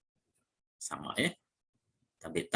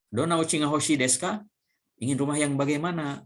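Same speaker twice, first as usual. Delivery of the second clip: higher, brighter questioning voice; asking partner in the dialogue; 6 semitones higher, faster than usual. Acted. Teacher acting the curious questioner in the practice dialogue, asking brightly. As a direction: play the one asking what kind of house.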